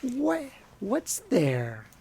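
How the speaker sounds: put-on high, slow, drawn-out child voice; imitating little Chip. Fan imitates Chip asking Missus Potts, high and slow. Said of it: "Chip ... he's like".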